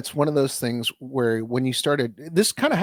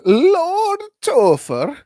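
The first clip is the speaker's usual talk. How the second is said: drawn-out, higher, louder mock-lordly title; mock-grand introduction; 8 dB louder, much slower than usual. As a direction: announce yourself as a lord with hammed-up grandeur. Mock-grand self introduction of host.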